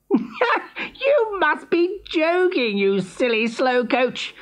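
high, slow, laughing sneer. Acted. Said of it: "sneered Hare".

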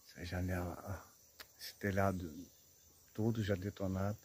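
soft, low tour guide murmur; hushed voice. Real, not acted. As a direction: keep it hushed, wary of the area. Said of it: "eu vou falar baixinho".

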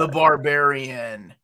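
drawn-out repeat after a What, slowed down. Real, not acted. Friend didn't catch it, slow clear repeat. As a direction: spell it out slowly, stretching The Barbarian.

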